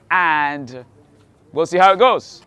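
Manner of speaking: normal talking voice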